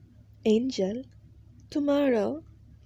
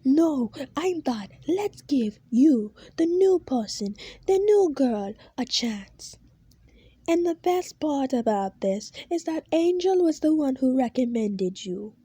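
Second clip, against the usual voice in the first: higher, louder furious rant; shouting boss; 4 semitones higher, 4 dB louder than usual. Acted. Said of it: "he says as he starts to shout".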